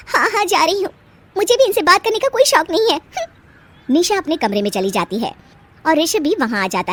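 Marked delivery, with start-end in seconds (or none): 0.1-3.0 higher, sulky retort; huffy young wife. Acted. Bride leaves in a huff. 3.0-3.9 huff. Acted. Bride huffs off.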